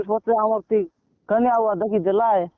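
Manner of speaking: ordinary delivery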